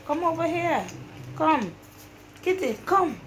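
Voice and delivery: high-pitched coaxing call; calling a kitty. Real, not acted. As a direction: call the kitten over, pitch lifted. Calling the kitten over.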